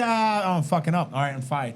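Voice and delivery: normal talking voice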